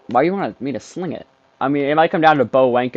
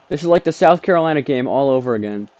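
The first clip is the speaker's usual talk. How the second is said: usual speaking voice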